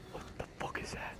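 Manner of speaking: scared whisper. Real, not acted. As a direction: breathe it in fear. Scared gamer repeats, whispering.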